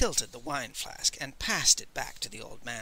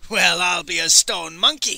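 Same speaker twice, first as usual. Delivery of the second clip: put-on loud, surprised old man's cry; exclamation; 5 semitones higher, 12 dB louder than usual. A narrator's reading. Old Man gapes at the boy's mind, loud exclamation. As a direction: burst out in delighted astonishment. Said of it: "he exclaimed".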